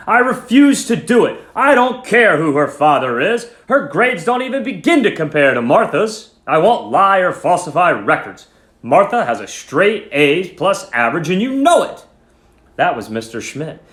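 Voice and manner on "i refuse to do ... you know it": loud, high, furious teacher; very angry. Acted. Teacher Mister Schmidt arguing with a colleague, angry raised voice. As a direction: refuse him hotly, voice raised. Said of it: "he sounded very angry".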